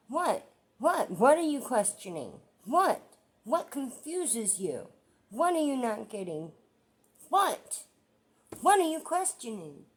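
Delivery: loud, high repeated What; angry outburst. Real, not acted. Vlogger yelling at trolls who left question marks, furious. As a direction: furiously demand what they don't understand.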